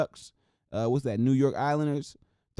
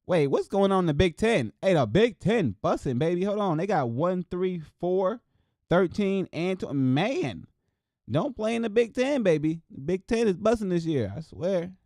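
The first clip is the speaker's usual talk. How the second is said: excited; 6 semitones higher, 3 dB louder than usual. Real, not acted. Hyped about Big Ten standings.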